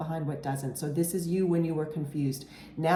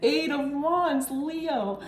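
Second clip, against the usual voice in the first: amazed; 10 semitones higher, slower than usual. Real, not acted. Reader amazed by the card she drew for Leo. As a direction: marvel at the card, drawing it out.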